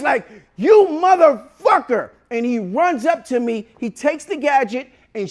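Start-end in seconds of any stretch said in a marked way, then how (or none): 0.6-2.1 slow, loud, high-pitched imitated rage; furious curse. Kicked dentist charging at patient, furious shout. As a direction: draw the insult out in fury.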